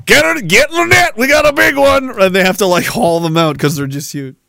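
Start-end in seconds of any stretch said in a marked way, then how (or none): 0.0-2.1 mock catcher shout. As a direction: yell it like hauling in a catch. Fat-catching crew shouting.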